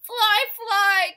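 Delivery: high, loud alarm call; urgent bird cry. Acted. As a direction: shout it out to warn the bees. Birds call to bees.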